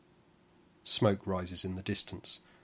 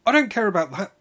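loud, much higher furious bellow; 12 semitones higher, 13 dB louder, faster than usual. Acted. Orinauta in labour bellowing at the midwife, loud. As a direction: roar it at her. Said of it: "bellowed Orinauta".

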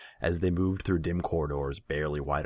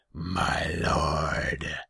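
loud, drawn-out demon growl; rumbling growl; 2 semitones higher, 6 dB louder than usual. A narrator's reading. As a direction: rumble it like a big cat demon. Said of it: "His voice was a rumbling growl".

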